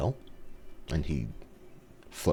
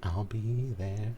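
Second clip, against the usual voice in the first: drawn-out, higher mimicking echo; teasing imitation; 5 semitones higher, slower than usual. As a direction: echo it back slowly, pitched up. Listener mimics the whispered line.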